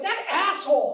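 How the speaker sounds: loud, high mock outrage; road-raging wife. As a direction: imitate a wife cursing another driver. Acts out wife's road-rage texts.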